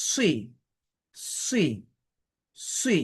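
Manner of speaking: সি with lips lightly rounded, thick and hissed; emphatic sad with kasra. Shown as a demonstration. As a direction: round the mouth a little on সি. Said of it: "মুখটা হালকা গোল হবে".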